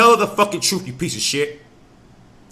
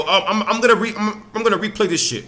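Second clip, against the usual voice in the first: normal talking voice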